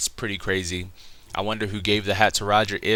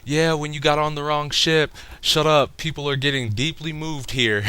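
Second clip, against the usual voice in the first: high, raised-voice mock scolding; Sanji, mock pissed off; 7 semitones higher than usual. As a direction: tell them off in a hammed-up angry voice. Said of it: "Sanji gets all pissed".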